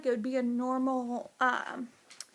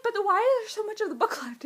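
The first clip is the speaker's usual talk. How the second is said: fast, higher, puzzled; puzzled worry; 7 semitones higher, 3 dB louder, faster than usual. Retold or imitated. Reader noticing how much book is left, puzzled. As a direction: wonder aloud, suspicious. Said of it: "And I was like".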